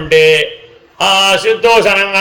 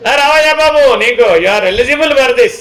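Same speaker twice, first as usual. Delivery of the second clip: grand welcome; 6 semitones higher, slower than usual. Retold or imitated. The whole universe beckoning the sage, lifted and slow. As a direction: roll out the carpet for the sage. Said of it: "జ్ఞానిని పిలిచిస్తారు ఇవన్నీ".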